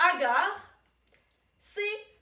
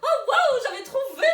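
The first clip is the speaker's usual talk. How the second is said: high, loud delighted exclamation; wowed, laughing outburst; thrilled celebration; 6 semitones higher, 5 dB louder than usual. Real, not acted. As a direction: crow happily about guessing right. Celebrating a correct guess.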